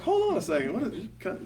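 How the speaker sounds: high-pitched, mock-offended comeback; mock indignant. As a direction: play at being taken aback. Acts own indignant comeback.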